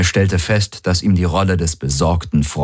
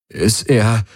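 higher, faltering half-question; breaking voice; 4 semitones higher than usual. A narrator's reading. Valyr feigns grief before the guards, voice breaking. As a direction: let the voice give out mid-question. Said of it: "ließ seine Stimme brechen".

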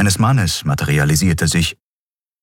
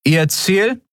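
higher, brisk official query; guard's curt question. A narrator's reading. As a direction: demand it briskly. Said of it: "guard questions".

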